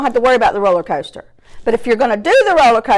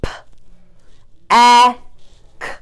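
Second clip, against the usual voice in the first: "P-, a-k" split, A held long; chopped word. Shown as a demonstration. Teacher chopping a word for pupils, sounds split apart. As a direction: chop PACK with gaps. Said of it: "let's chop".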